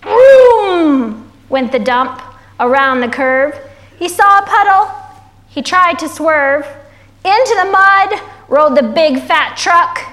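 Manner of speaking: animated, rhythmic picture-book narration; story reading to children. A narrator's reading. Reading the dump truck's mishap to kids, animated storytelling. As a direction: punch the rhymes, opening on a drawn-out vroom.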